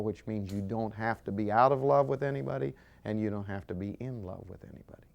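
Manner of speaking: usual speaking voice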